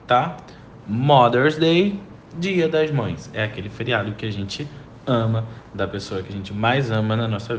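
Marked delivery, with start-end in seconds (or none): 0.9-2.0 Mother's Day said slowly and clearly, stress on Day rising; teacher models Mother's Day slowly; slow model pronunciation. Shown as a demonstration. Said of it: "vamos falar sobre Mother's Day". (pronúncia lenta e clara de Mother's Day)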